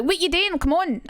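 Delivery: retold exasperation at someone dawdling; pissed off. Retelling snapping at Stefan before the chippy, pissed off. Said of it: "I got pissed off at Stefan".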